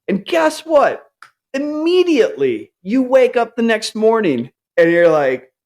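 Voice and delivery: ordinary delivery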